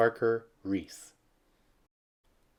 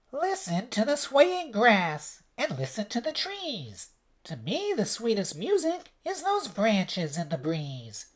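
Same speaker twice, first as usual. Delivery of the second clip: the kind cricket soothing sad Gerald; gentle cricket; 11 semitones higher than usual. A narrator's reading. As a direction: coax him like a kind little cricket. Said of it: "coughed a cricket".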